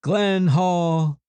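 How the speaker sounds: slow, drawn-out host intro; podcast host intro. Acted. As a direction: stretch out the show intro.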